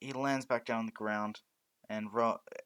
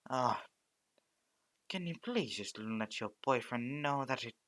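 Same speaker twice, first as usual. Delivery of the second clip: usual speaking voice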